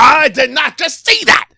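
very high, loud, slow mock yell; mock commentator shout. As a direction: scream it like an overexcited announcer. Imitating Booker T call.

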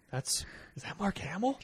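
soft, high, hesitant question; imitated puzzled recognition. Movie fan replaying the first sight of an actor, puzzled. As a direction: act out squinting doubt, rising at the end.

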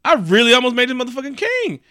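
high-pitched, hammed-up outrage; really mad. Odin realizing who he nearly crowned, escalating outrage. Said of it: "he got really mad".

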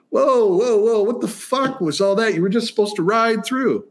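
hammed-up flustered director stopping the take; Mel Brooks. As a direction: director rushing onto set, high and alarmed. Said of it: "Mel Brooks came running out going".

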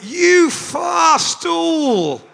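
high, slow, hugely stretched shout; retold shout. Storyteller shouts at the stool in the distance, long mock holler. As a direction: holler it across a distance, every word drawn out. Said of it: "I just shouted at it".

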